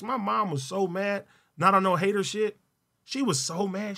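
ordinary talk

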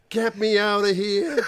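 high, slow pleading monkey. Retold or imitated. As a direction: whine it like a trapped monkey. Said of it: "Monkey going".

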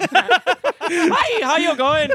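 high, loud Irish greeting; Irish accent. Retold or imitated. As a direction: sing out a lilting Irish hello. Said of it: "Is that Irish, too?".

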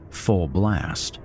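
plain reading voice

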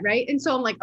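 usual speaking voice

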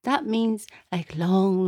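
usual speaking voice

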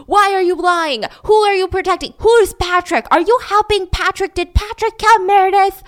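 loud, high volley of questions; screamed interrogation. Retold or imitated. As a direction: act out cops yelling accusations. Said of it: "They're all screaming now".

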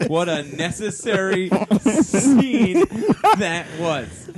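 laughing through words. Real, not acted. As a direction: laugh while saying it. Laughing at the script.

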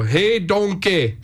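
slow, stretched Scottish Shrek quote; Scottish accent. Shown as a demonstration. Host showing co-host a Scottish accent, hammed-up Scottish. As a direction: put on a cartoonish Scottish accent. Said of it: "your best Scottish accent".

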